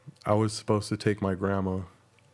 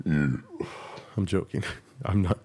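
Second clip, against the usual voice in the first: ordinary talk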